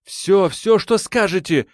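high, urgent, cutting in; urgent interruption. A narrator's reading. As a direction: jump in before he finishes. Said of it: "перебила она его".

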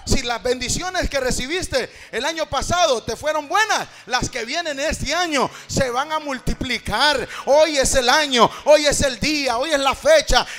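loud, ringing revival preaching over the music; full-force preaching. Acted. Pastor exhorting the congregation to receive blessing, loud full-force preaching. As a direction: preach it out to the whole church, loud.

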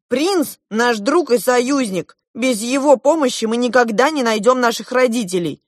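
high, loud, insistent scolding; lashing out. A narrator's reading. Girl defending the prince to Klop, lashing out. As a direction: berate him emphatically. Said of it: "накинулась я на Клопа".

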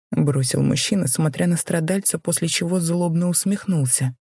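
usual speaking voice

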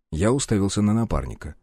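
ordinary delivery, nothing marked

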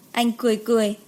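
ordinary delivery, nothing marked

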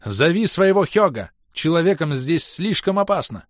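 high, loud urgent warning; urgent shout. A narrator's reading. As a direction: shout an order over the danger. Comrades shouting warnings amid battle.

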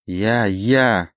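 mildly indignant; a touch of indignation. A narrator's reading. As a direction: protest with understated outrage. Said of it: "возмутился Олег".

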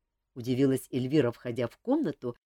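ordinary delivery

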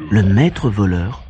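usual speaking voice

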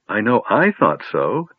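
ordinary delivery, nothing marked

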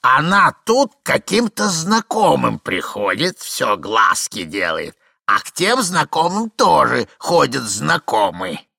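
put-on loud, high, drawn-out old voice; gleeful old man. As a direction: savour the gossip slowly. Plays gossiping old man.

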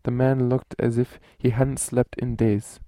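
usual speaking voice

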